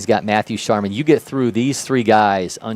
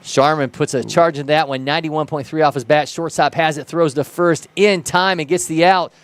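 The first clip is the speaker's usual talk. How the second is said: higher-pitched running call of the play; live play-by-play; 6 semitones higher than usual. Real, not acted. Commentator calling a groundout to shortstop, animated play-by-play. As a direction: follow the ball to first, pitch raised.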